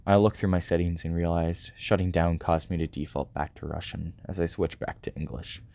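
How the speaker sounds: ordinary talk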